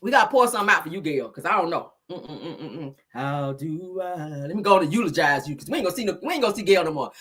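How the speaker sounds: ordinary talk, nothing marked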